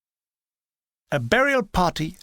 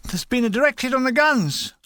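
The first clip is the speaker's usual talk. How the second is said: blurted alarm; 7 semitones higher than usual. Retold or imitated. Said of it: "I blurted out".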